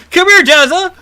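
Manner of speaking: frantic laughing call; being chased. Real, not acted. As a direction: yell for your friend in panic. Fleeing bear, calling friend.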